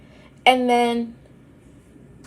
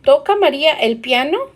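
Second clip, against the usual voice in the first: "¿Toca María el piano?" quick, rising on "piano"; teacher shows the rise; rising question tune; 2 semitones higher, faster than usual. Shown as a demonstration. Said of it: "make sure that you go".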